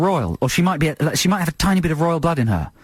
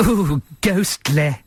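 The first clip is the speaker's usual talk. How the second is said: imitating the actress; much slower than usual. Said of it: "I love the way she says that".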